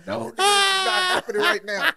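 high, strained half-laughing panic; panicked crying. Retold or imitated. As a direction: retell the terror with a cry in it.

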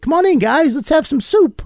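put-on high, welcoming older guy inviting kids in; lightly put-on voice, beckoning them inside; high friendly host voice. [name] greeting the kids at the door, high and friendly.

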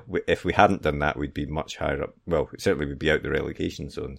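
ordinary delivery

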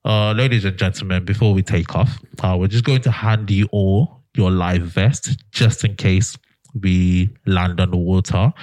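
low, slow intercom announcement; deep, unhurried captain on the PA; pilot voice. Acted. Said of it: "and they go".

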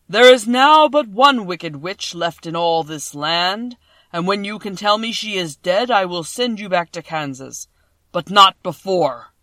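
a vast, slow, thundering wizard; voice of the great Oz. A narrator's reading. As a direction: boom each word out like a huge magic head.

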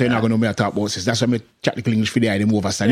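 fast Jamaican patois; quick Jamaican patois demo. Shown as a demonstration. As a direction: rattle it off in broad Jamaican. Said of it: "So that's Jamaican".

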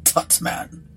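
short acted gentlemanly chuckle; mild, measured mock laugh; captain's laugh. A narrator's reading. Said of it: "The captain laughed".

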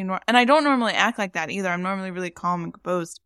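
ordinary talk

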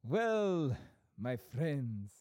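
high, hammed-up Italian accent; lilting mock Italian with stretched endings. Shown as a demonstration. Host starting the farewell, exaggerated Italian accent. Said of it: "I can do the Italiano".

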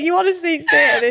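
high-pitched laughing jibe; real amused ribbing; laughing tease. Real, not acted. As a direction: poke fun while laughing. Teasing co-host over notes.

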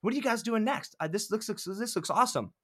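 imitating an eager question about what is next; pumped-up imitation. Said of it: "She gets all pumped up".

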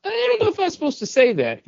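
high, fast mock-sheepish voice; Robert Kirkman impression. Podcaster retelling the writer's spoiler, in a high, fast mock-sheepish voice. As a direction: squeak it out like a guilty fanboy. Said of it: "Robert Kirkman goes".

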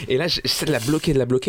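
usual speaking voice

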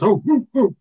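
high, loud dog-like hooting. Retold or imitated. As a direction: hoot it out like an excited dog. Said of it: "in a dog voice".

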